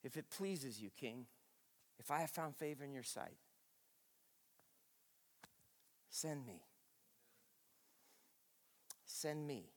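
low, soft, sincere prayer; preacher praying softly to God; tender prayer. Acted. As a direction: offer yourself gently and sincerely. Quiet surrendered prayer.

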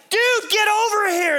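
high, urgent shout across the water; yelling to friends. Retold or imitated. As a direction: holler them over, full voice. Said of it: "he yells at the guys".

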